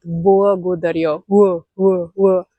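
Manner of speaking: Благодарю, then "wo, wo, wo" from the throat; W-like L drill. Shown as a demonstration. Teacher drills the throaty L sound for students. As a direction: slowly drill the L as "wo". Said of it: "it's here in the throat".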